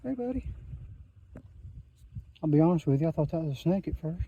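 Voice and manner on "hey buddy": hushed and higher, greeting a small animal; softly greeting a lizard on the trail, hushed; soft hello to a lizard. Real, not acted. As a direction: coo gently so you don't scare it off.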